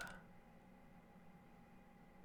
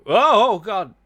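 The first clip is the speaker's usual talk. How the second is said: high, loud startled cry; shocked shout; 9 semitones higher, 12 dB louder than usual. Real, not acted. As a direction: cry out in disbelief. Game quits unexpectedly.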